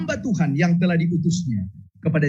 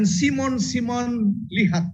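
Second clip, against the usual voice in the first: voicing Jesus; 8 semitones higher than usual. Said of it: "dia katakan".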